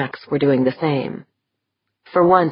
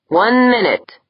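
announced; 7 semitones higher, 4 dB louder than usual. A narrator's reading. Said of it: "Dorn announced".

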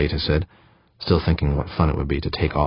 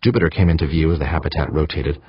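ordinary delivery, nothing marked

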